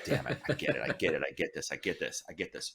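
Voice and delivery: hushed whisper, urgent, willing himself on. Retold or imitated. Fan sneaking up on [name], whispering to himself. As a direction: whisper it under your breath, psyching yourself up. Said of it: "I'm like".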